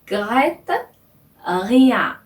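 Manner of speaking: "Regrette rien" with a gargled French R; throaty R demo. Shown as a demonstration. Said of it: "이런 식으로 발음을 하는 겁니다". (목 안쪽 R로 또렷하게 "Regrette rien")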